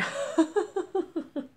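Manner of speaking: high, loud burst of laughter; delighted laugh. Real, not acted. Crafter finds her friend's joke gift perfect, delighted high laugh. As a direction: crack up in a run of high ha-has.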